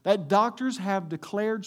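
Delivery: very loud, high preacher's call; full-force preaching. Acted. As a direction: proclaim it to the whole room.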